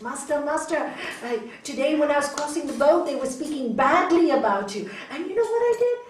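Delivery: high, excited tattling to the master; acted breathless-to-tell disciple; eager report. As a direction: rise keenly toward a proud reveal.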